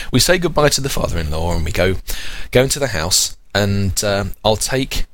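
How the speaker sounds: ordinary delivery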